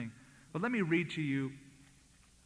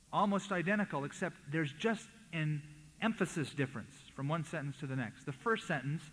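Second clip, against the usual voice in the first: normal talking voice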